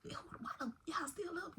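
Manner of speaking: put-on high, hushed hissing at the kids; a mama bursting in, hissing; scolding auntie. Auntie bursting into the room, hushed high scolding. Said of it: "bust up in there like".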